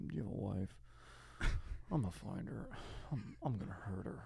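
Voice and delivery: quiet, menacing villain quote; Philip Seymour Hoffman. Acted. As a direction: murmur the threat softly, unhurried. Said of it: "Philip Seymour Hoffman wakes up".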